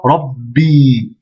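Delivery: "Rabbi" blocked on the b, then a long i; doubled consonant. Shown as a demonstration. Teacher demonstrating the shedda, "Rabbi" with doubled b, long i. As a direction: stop on "Rab", then release a held "bi". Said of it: "au-dessus de la shedda".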